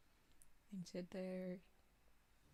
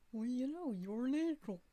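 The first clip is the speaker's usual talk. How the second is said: character voice; 4 semitones higher, 5 dB louder than usual. Acted. Voicing a game character's line.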